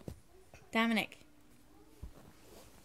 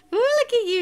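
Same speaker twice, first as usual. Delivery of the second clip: high, loud delighted coo; excited praise; 10 semitones higher, 7 dB louder than usual. Real, not acted. Mom thrilled as her baby starts to crawl, high excited coo.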